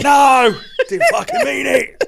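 high, loud hammed-up Cockney protest; Cockney geezer. Client taking back an outburst, loud high Cockney accent. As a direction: yelp it in mock Cockney, laughing. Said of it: "I went full Cockney geezer".

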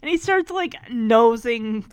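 ordinary delivery

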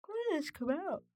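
talking through a yawn; mid-yawn words. Real, not acted. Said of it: "excuse me for yawning".

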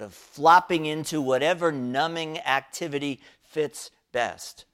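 normal talking voice

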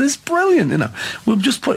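high-pitched mock enthusiasm; delighted retelling. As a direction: act out glee at a prank, voice shooting up. Acting out delighted prank idea. (hoog nagespeeld enthousiasme)

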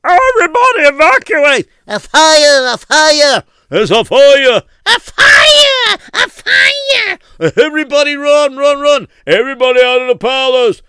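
high, loud panicked shouting; screaming fire. Acted. Servants wake the palace to the fire, screaming. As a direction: yell the alarm at the top of your lungs. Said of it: "she started to hear screams".

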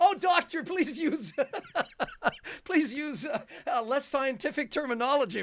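high, giggling sarcastic plea; laughing mock plea. Players mocking the doctor's word choice, laughing plea. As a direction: beg the doctor through laughter.

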